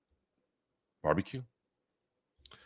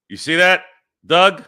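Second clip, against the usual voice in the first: much higher and louder, crowing at Doug; gloating call-out; 10 semitones higher, 10 dB louder than usual. Real, not acted. Streamer crowing at chatter Doug after the grand slam, loud and gleeful. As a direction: rub the grand slam in his face.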